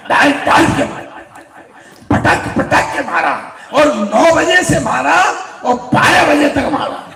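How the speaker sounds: a rising laughing rant ending in a shout; building comic frenzy. A narrator's reading. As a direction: pile up the blows with glee. Comic climax of the beating.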